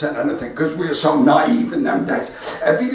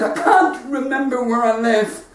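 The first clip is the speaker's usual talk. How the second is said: mock crying; 5 semitones higher than usual. Old man lost on the curb, mock crying. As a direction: wail it like a confused old man.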